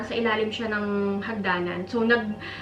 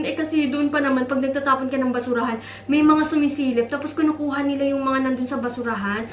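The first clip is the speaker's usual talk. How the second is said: normal talking voice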